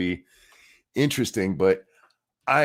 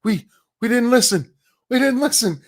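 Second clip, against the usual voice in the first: mock dismay, rising to a high wail; mocking shocked journalists; 4 semitones higher than usual. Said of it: "how they were like".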